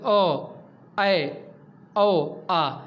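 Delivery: अ, ऐ, औ, आ each sounded slowly with the mouth half open; slow vowel demo. Shown as a demonstration. Teacher modelling half-open vowels for students, slow and careful. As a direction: draw out every vowel for the class to copy. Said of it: "जिन स्वरों के उच्चारण में मुख आधा खुलता है".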